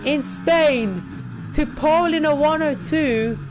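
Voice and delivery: real thrilled commentary at the top of the voice; ecstatic. Real, not acted. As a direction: shout it like you just took pole. Takes pole, celebrates.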